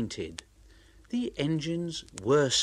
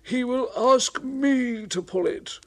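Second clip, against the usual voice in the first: high, mocking scorn; scoffing Henry; 10 semitones higher, 4 dB louder, slower than usual. Henry mocking James's chances. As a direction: sneer at the rival.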